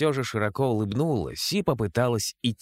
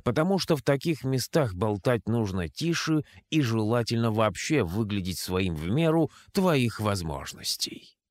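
ordinary delivery

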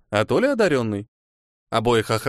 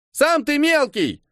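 put-on high, slow, piping kid's voice, offended; a little boy yelling back over the fence; indignant child; slower than usual. A narrator's reading. Little boy over the fence, indignant high child voice. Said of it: "возмущённый детский голос".

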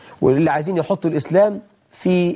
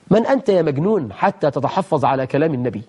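ordinary talk, nothing marked

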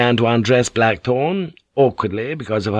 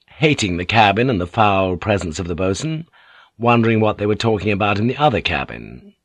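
ordinary talk

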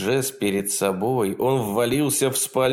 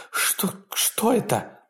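high, slow terrified voice; 11 semitones higher, slower than usual. A narrator's reading. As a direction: stammer it out in fear. Woman sees the monster.

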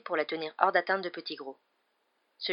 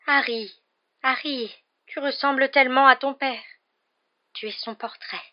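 ingratiating plea; 7 semitones higher, 7 dB louder than usual. Acted. Grovelling villain appeals to Harry.

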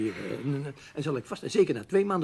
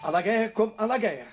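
resigned rallying cry; 7 semitones higher, 7 dB louder than usual. Acted. Zichzelf moed inspreken.

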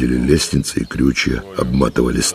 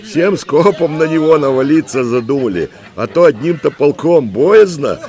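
high, loud mock-fearful banter; merry shouting; 13 semitones higher, 4 dB louder than usual. A narrator's reading. Soldiers joke to the commander about the fearsome old man, merry shouting. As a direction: shout it up high as jeering playful complaint. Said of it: "весело кричали ратники".

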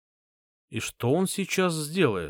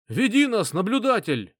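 rallying cry; 9 semitones higher than usual. A narrator's reading. Rallying call to leader.

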